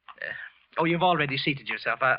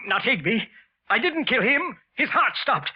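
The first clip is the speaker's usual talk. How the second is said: high, rising frantic protest; hysterical denial; 9 semitones higher, 3 dB louder than usual. Acted. Wilson screaming at the accusing voices, frantic. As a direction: deny it in mounting panic.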